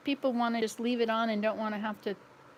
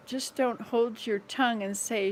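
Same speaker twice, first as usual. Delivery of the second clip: ordinary talk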